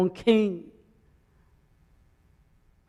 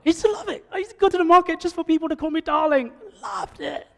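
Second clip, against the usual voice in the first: normal talking voice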